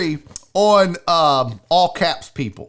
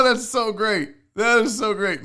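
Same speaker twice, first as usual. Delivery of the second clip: ordinary talk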